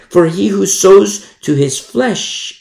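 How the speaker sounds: slow, loud reading with flesh hit hard; stress on flesh. Shown as a demonstration. Preacher reads the verse to the saints, emphasis on flesh. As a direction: read it out slowly, punching "flesh". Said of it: "emphasis on the flesh".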